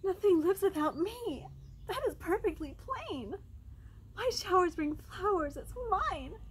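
high, wailing storybook rain; high, insistent claim; crying-out rain. A narrator's reading. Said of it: "cried the rain".

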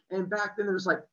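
usual speaking voice

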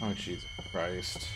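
ordinary talk

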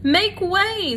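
high, loud herald call for the crowd; Furlough calling. A narrator's reading. Furlough pushes through the mice, loud high call. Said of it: "said Furlough".

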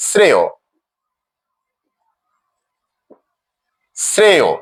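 শ্রেয় ending in a clear "o"; pronunciation demo. Shown as a demonstration. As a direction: say শ্রেয় as "sreyo", with falling pitch. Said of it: "ও রূপে উচ্চারিত হবে".

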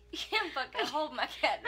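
loud mock outrage breaking into laughter; laughing outburst. Cat owner retells banning the girl from holding it, laughing hard. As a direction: scold through helpless laughs.

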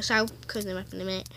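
usual speaking voice

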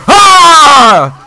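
high, long scream of frustration; frustrated yell; loud scream. Real, not acted. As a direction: let out a full-voice scream. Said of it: "then I can scream louder".

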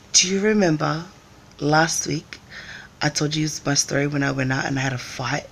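ordinary delivery

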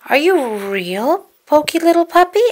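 Bert asking. Acted. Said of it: "asked Bert".

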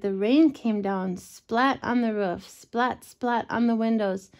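ordinary delivery

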